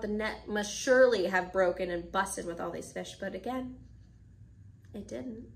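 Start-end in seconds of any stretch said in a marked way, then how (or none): none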